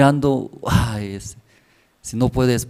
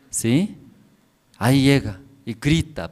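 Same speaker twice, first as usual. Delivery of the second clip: ordinary talk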